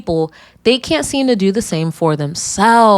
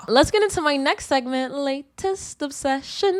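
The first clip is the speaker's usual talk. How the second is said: segment announcement; 6 semitones higher, 5 dB quieter than usual. Acted. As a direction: announce the segment like a show host.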